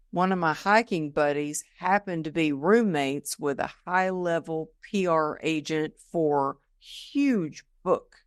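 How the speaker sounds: ordinary delivery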